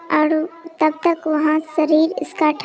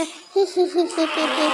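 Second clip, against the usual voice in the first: witch cackle. Acted.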